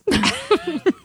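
a real, high burst of giggling; high giggle. Real, not acted. Bartender giggling to the host after admitting her game, high laugh. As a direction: giggle helplessly at your own confession.

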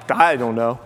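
low, dopey shrug in the voice; clueless. Retold or imitated. Lost driver admitting he has no bearings, low and clueless. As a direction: drop low, utterly stumped. Clueless when the sun is overhead.

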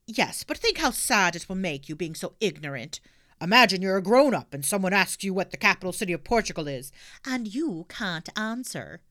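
loud lecturing officer; stern constable warns; policeman voice. Acted.

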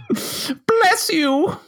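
high-pitched, put-on old lady. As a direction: bless them like a little old lady. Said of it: "working on my old lady voice".

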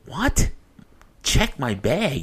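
high-pitched, disbelieving question; incredulous. Retold or imitated. Told he must check his bag, incredulous.

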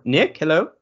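calling to someone outside the room. Real, not acted. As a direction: call out to the next room. Calling to roommate at door.

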